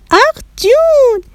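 very high, drawn-out squeal of delight; delighted cheer. Acted. Children delighted by the lunch menu, excited cheer.

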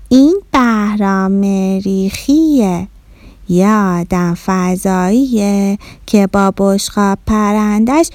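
very slow, stretched-out words; drawn-out explanation. Acted. Child introducing a Martian guest to mum, slow explanation. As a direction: spell it out slowly for mum.

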